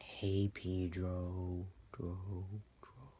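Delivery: slow, drawn-out line fading like an echo; haunting echo. Retold or imitated. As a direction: stretch it out and let the last syllable repeat and fade. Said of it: "It just plays back in my mind".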